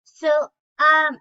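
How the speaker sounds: ordinary talk, nothing marked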